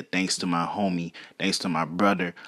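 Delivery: ordinary delivery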